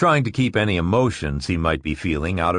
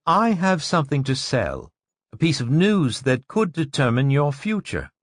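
higher, hard and thin woman's voice; 6 semitones higher than usual. Acted. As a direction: brittle as glass. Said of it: "Her voice was hard and thin".

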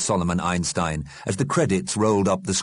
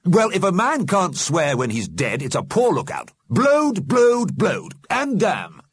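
high, louder, indignant character voice; defiant Alderman; 12 semitones higher, 5 dB louder than usual. A narrator's reading. The Alderman swears back at Missus Liberty, defiant. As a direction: punch out each mild curse.

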